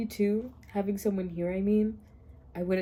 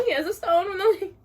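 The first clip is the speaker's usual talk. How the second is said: laugh-crying; 8 semitones higher, 4 dB louder than usual. Real, not acted. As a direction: voice cracking with laughter. Crying laugh over book.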